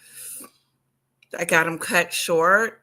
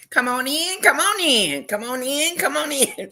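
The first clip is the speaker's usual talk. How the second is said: mock streamer sing-song welcome, higher; teasing imitation of content creators; 8 semitones higher than usual. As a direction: chant it like a hyped streamer inviting viewers. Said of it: "that's how they talk".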